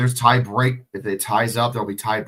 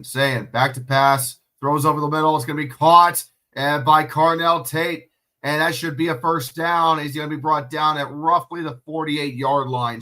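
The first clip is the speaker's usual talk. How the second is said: fast sportscaster call, rising to a loud peak on the sack; play-by-play call; 3 semitones higher, 3 dB louder than usual. Acted. Live play-by-play of the game.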